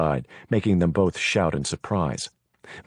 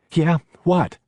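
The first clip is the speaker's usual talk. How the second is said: startled stammer, pitched up; 10 semitones higher, 4 dB louder than usual. A narrator's reading. Nancy startled by the ringing phone, stammering. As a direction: blurt it out, caught off guard. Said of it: "she stammered into it".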